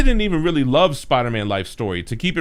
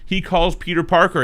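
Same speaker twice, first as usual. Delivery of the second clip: normal talking voice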